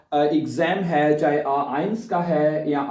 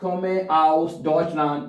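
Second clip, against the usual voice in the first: slow, deliberate German; slow demo; much slower than usual. Shown as a demonstration. Teacher answering his own question, slowly. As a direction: say it slowly for the class. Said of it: "क्या कहेंगे".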